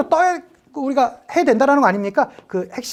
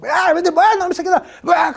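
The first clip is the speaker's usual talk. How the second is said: cocky servant's taunt; 6 semitones higher than usual. Acted. Servant picks a quarrel.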